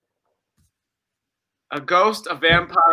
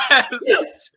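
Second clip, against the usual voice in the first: excited; 10 semitones higher than usual. Real, not acted. Celebrating a matching answer.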